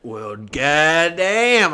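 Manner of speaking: slow, drawn-out goddamn of disbelief; fed-up groan of a line; exasperated drawl. Retold or imitated. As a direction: let it drag out in disbelief. Exasperated at extra card fee.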